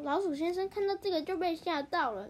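plain reading voice

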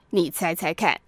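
a touch of swagger, softly; mildly pleased with himself; cocky. A narrator's reading. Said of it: "很神气地说". (略带神气地说)

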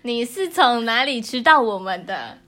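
usual speaking voice

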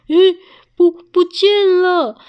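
high, loud stammer of disbelief; alarmed shock. Acted. Bear finds his chestnuts gone, alarmed. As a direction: stretch out the shocked discovery.

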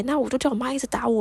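ordinary delivery

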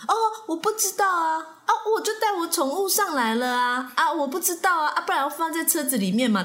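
mock auntie whining she didn't know; whiny auntie. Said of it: "然后那个小姐原本她可能还说".

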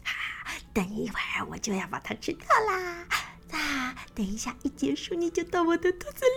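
whispered, fast, gloating to himself; a greedy secret plan, whispered; sneaky whisper. Acted. Crocodile thinking about eating the bird, whispered gloating. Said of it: "心里想着".

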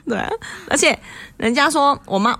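usual speaking voice

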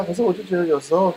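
ordinary delivery

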